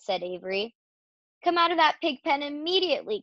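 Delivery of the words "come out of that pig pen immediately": high-pitched scolding order; cross mother, read aloud; Mrs. Arable's cry. A narrator's reading. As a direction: order a child out urgently. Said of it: "cried Missus Arable".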